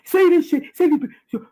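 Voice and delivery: high, loud mock voice of Tasha K. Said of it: "Tasha K put him up to these lies".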